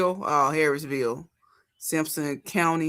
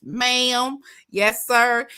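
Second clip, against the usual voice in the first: higher, sing-song polite southern voice; mocking southern politeness; 6 semitones higher, 4 dB louder, much slower than usual. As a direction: mimic an overly polite southerner.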